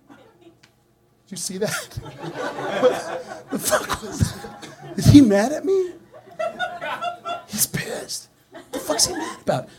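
hushed, squeaky mock disbelief; stunned whispering. Newcomer left standing after the snub, stunned stage whisper. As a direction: whisper it, cracking up high.

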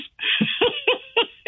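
a bright, high burst of laughter. Real, not acted. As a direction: laugh it off, amused. Laughs about the heat.

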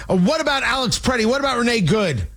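mock screaming. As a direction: imitate someone screaming, only in pitch. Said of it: "And, and screaming".

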